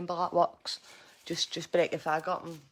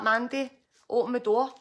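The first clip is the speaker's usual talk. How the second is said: loud, higher calling demand; imitated police call; 5 semitones higher, 8 dB louder than usual. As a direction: bellow it through the window. Officers in garden calling her.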